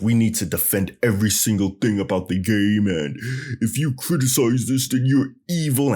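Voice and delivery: mocked game defender. Vlogger imitating fans who defend everything about the game, mock-indignant. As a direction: parody an overzealous fan, pushing the pitch up toward the end. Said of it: "defender's route in terms of".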